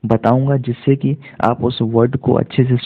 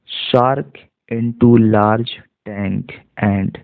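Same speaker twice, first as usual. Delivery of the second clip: slow reading demo; much slower than usual. Shown as a demonstration. Teacher reading slowly word by word to students. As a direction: read each word out slowly, one by one. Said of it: "पढ़ करके मैं बताता हूँ".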